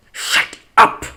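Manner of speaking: harsh, very loud snapped shout; angry shout. Acted. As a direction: bark it at him angrily. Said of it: "I turned to him angrily".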